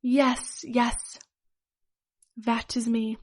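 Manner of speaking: lightly put-on German accent; a hint of a mock German accent. A narrator's reading. Villain revealing himself to a fan, light German accent. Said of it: "in an extremely German, Germanic accent".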